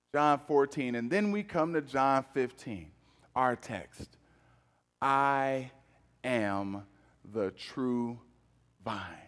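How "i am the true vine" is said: very slow and soft, lower; slow reverent reading. Acted. Pastor reads Jesus's words to the congregation, slow and hushed. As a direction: linger on each word, reverent.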